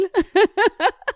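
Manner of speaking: a rising burst of high laughter. Real, not acted. Laughing about parents owning unreadable books. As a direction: laugh it off with delight.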